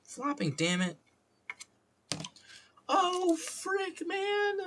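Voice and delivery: very high, squealed mock frustration; fake cursing. Streamer doing clean curses, high mock-upset voice. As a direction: climb into a silly high fake swear. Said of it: "like, the fake curses".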